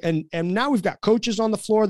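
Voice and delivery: normal talking voice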